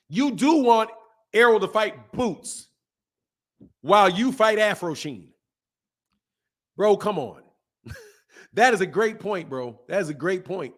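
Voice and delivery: usual speaking voice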